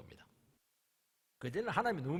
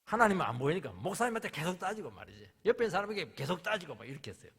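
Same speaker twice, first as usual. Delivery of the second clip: usual speaking voice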